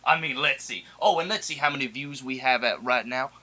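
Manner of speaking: ordinary delivery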